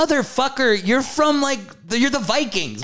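high-pitched outraged disbelief; incredulous rant. Retold or imitated. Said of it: "I was like".

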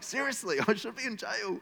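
laughing incredulous protest; exasperated Peter. Retold or imitated. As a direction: Peter knocking at the gate, laughing in disbelief. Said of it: "he's like".

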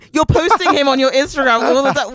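high-pitched exasperated question; comically outraged demand; incredulous. Acted. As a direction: challenge them in comic disbelief, voice way up. Mock-challenging the couple.

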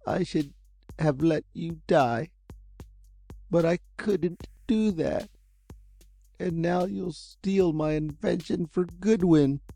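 put-on a beaten old inventor, words dragging; slow, hopeless. A narrator's reading. Old inventor admits defeat to Jerry, slow and hopeless. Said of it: "he said slowly, hopelessly".

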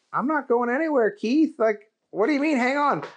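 confused friend on the phone; Booger voice. Acted.

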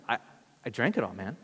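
low and soft, mock guilty admission; sheepish. Friend admitting he drank all the water, sheepish and soft. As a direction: mumble it low like caught out. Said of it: "He's like".

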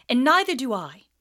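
furious schoolboy; angry Harry. Acted.

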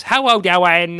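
very high, loud mock actress squeal; nails-on-chalkboard voice. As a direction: imitate her grating, cutesy screech at full volume. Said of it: "nails on a chalkboard and horrible".

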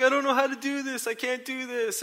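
high-pitched mock whine; hammed-up helpless wail in words; distressed protest. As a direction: imitate a tearful, panicked beginner. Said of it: "she starts crying".

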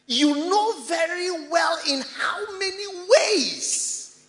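loud, high proclamation with drawn-out words; preach. Acted. Preacher blessing the helper with Paul's prayer, loud preaching. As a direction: proclaim the blessing at full force.

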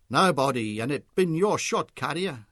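loud, brash Charlie voice; denial. A narrator's reading. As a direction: brush him off loudly. Charlie denying it.

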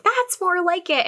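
elated. Retold or imitated. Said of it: "And she's elated".

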